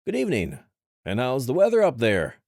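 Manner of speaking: high, loud chirpy greeting; forced bright chirp at a giant; cheery chirp. Acted. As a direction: chirp it out to hide discomfort. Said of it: "adopting a cheery tone and chirping out".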